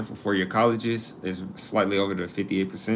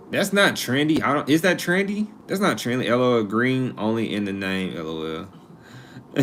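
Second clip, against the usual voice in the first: ordinary delivery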